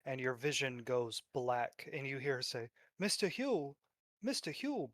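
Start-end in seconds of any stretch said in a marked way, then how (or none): 3.0-4.9 distant calling. A narrator's reading. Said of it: "but it sounds far, far away".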